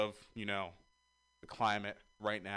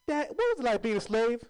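put-on little girl; 11 semitones higher, 5 dB louder than usual. Little girl asks her dad.

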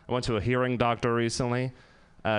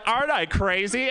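proud ditzy Becky; 7 semitones higher than usual. Retold or imitated. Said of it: "she's like".

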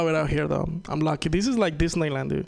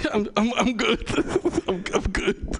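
laughing through it; 5 semitones higher than usual. Retold or imitated. Morning fart sniff quote.

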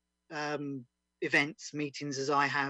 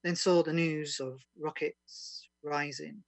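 poem recital. Acted. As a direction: recite it like a solemn spoken-word piece. Reads a serious protest poem.

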